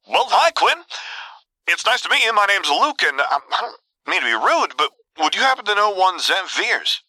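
calm, pleasant, high-pitched voice; be politely pleasant, pitched well up; Luke. A narrator's reading. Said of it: "as calm and pleasant as could be".

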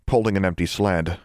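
ordinary delivery, nothing marked

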